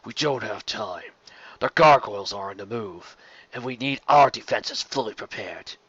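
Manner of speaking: understated gruff dragon sneer. Acted. Dragon warning the queen about gargoyles, understated sneer. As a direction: press the point with muted contempt. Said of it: "Rosa sneered".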